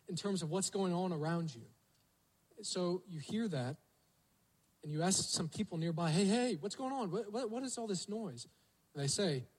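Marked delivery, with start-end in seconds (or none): 6.1-8.5 puzzled calling out. Acted. Blind man asks what's happening.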